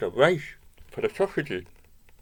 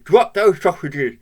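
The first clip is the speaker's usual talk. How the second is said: loud, higher-pitched command; dog's barked order; 4 semitones higher, 9 dB louder than usual. A narrator's reading. Bruce demands the stolen sausages, loud raised voice. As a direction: order it like an insistent dog. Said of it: "barked Bruce, meaning".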